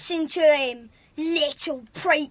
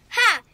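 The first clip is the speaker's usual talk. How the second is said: one short scornful ha; mocking laugh; 3 semitones higher, 5 dB louder than usual. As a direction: jeer at the silly badge. Bully mocking the badge.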